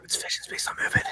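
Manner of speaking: hushed, quick movie-quote whisper. Acted. Joking about a predator that sees movement, whispered. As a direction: whisper it as if not to be noticed.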